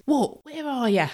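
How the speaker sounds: high, blunt London twang, imitating her; common London accent. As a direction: mimic a brash London woman on the phone. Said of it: "She spoke just like that".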